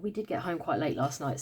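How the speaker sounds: ordinary talk, nothing marked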